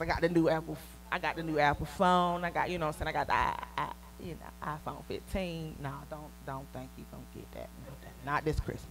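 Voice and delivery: ordinary talk, nothing marked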